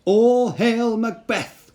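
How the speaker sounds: high, slow, otherworldly witch voice, each word drawn out; an uncanny crone hailing a general from the mist; eerie witch cry. Acted. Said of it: "cried the first".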